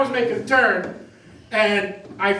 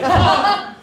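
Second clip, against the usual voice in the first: surprised oh; 11 semitones higher, 6 dB louder than usual. Retold or imitated. Popping up after being hit.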